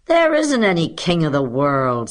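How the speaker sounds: loud, scornful contradiction; disgusted pupil. A narrator's reading. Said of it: "said Harry Jameson in disgust".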